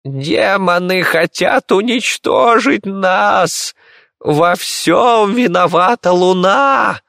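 put-on old lady's high, drawn-out wailing; wailing lament. A narrator's reading. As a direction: lament loudly, stretching words in a high voice. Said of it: "запричитала госпожа Баужей".

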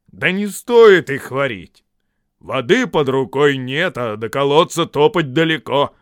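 loud, grumbling troll objection; complaining troll. A narrator's reading. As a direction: object loudly as a lazy troll. Disguised voice stirs up trolls.

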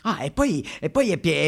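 ordinary talk

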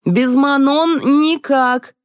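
high, drawn-out yell. A narrator's reading. Someone shouting in from the corridor, yelling. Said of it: "заорали из коридора".

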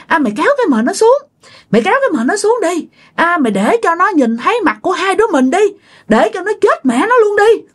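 high, fast, menacing ghost voice; yelling ghost woman. Acted. Ghost urges the other to uncover victims, high spiteful yelling. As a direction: cry out spitefully in a high pitch, without much volume. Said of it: "bà la làng lên".

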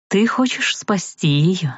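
ordinary delivery

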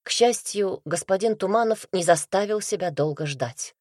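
ordinary delivery

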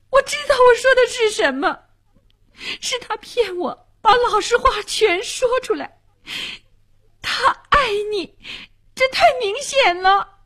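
put-on very high, impassioned girl's voice; agitated insistence. A narrator's reading. Girl tells the man her aunt loves him, agitated. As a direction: insist in high-pitched, wounded outrage.

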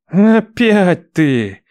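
very slow, mannered theatrical drawl; affected drawl. Acted. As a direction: ham it up like a stage magician. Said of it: "манерно протянул брат".

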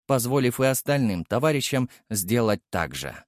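ordinary delivery, nothing marked

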